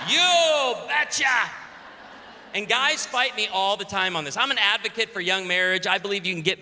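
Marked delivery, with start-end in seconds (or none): 0.1-1.5 put-on thick Norwegian accent. Norwegian father agreeing to the deal, big slow accent. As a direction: belt it slowly in broad Norwegian. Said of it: "in his thick Norwegian accent".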